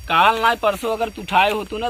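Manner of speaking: ordinary talk, nothing marked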